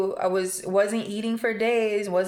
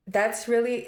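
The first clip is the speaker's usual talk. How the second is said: ordinary delivery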